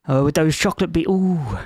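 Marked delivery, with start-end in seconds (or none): none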